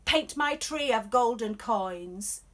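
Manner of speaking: loud, slow commanding order; emperor orders. Acted. Emperor orders Shen to paint his tree, loud and slow. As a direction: bark the command, every word weighted. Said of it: "He orders".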